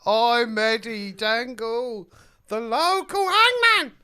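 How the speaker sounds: slow, high London hangman voice; rising high and slow in London accent. Acted. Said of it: "so I have to do a London accent?".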